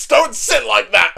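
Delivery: loud, high mock reprimand; hammed-up exasperated scolding, drawn out. Atty tells Demon Lord how to sit, scolding.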